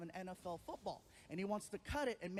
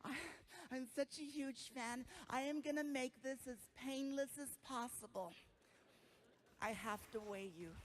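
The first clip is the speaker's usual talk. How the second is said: soft, sweet imitation of a fawning nurse; polite, hushed nurse voice; fan nurse; 3 semitones higher, 4 dB quieter than usual. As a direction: gently play the starstruck nurse. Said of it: "She comes over and she's like".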